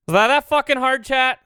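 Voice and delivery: loud, high exasperated yell; exasperated shout. Real, not acted. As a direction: yell at chat in triumph. Exasperated at chat after fix.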